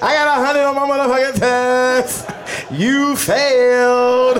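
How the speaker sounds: high, gloating schoolboy jeer; taunting kid. Retold or imitated. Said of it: "I'm like".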